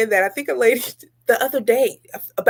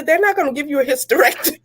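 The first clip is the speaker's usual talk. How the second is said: higher, fast, laughing; laughing through it; 6 semitones higher, faster than usual. Real, not acted. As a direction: rattle it off between laughs. Laughing at daughter.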